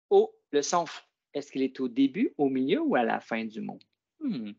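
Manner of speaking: normal talking voice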